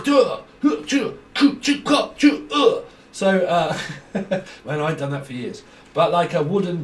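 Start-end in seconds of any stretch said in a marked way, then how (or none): 0.0-2.8 sharp loud kiai shouts; high shouted fighting grunts; martial arts grunts. Retold or imitated. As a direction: bark out each strike. Wing Chun strike grunts.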